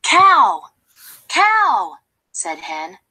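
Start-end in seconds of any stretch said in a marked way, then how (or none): 0.0-2.0 calling out. Acted. Hen calls cow.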